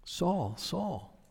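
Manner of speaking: soft, gentle calling of a name; a near-whispered tender call; voice of grace. Acted. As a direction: call him quietly, with grace. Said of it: "it's the voice of grace".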